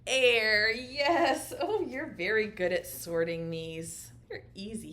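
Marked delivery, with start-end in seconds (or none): 0.0-0.7 louder mock kid's answer; teacher voicing the children's loud reply; pretend child shout. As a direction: bellow it as the class would. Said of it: "Say it".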